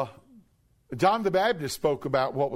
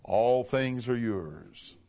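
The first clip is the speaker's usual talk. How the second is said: ordinary talk, nothing marked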